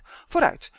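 high, loud call to move; urgent urging. Acted. Girl urges frightened boy onward, loud and urgent.

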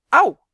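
sudden loud, high ouch; pained yelp. A narrator's reading. Malien pinches Justin's leg, a sharp pained yelp. Pinched hard mid-sentence.

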